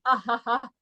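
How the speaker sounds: mock laugh in spaced ha's; imitated laugh. Laughing at an offended friend, imitated mocking laugh. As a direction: act out laughing in his face. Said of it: "I laughed to his face".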